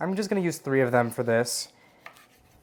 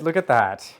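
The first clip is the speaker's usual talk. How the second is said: ordinary delivery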